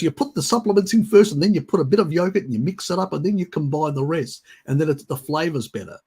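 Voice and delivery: high, insistent mock instructions; imitating fussy dad. Carer mimicking dad explaining yogurt mixing, mock fussiness. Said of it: "He gets really upset with me".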